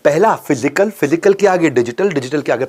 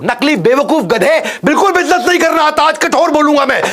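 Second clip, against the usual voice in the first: high, loud scolding rant; shouting at people who won't learn; angry; 10 semitones higher, 3 dB louder than usual. Real, not acted. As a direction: scold like a furious teacher. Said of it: "गुस्से में हूं".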